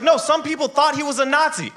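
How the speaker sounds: mock high squeal. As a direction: squeal it out, exasperated. Friend exasperated, then dumb answer.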